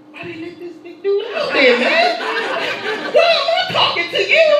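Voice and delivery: high, laughing panicked squeal; mock panic. Terrified nineteen-year-old facing killer.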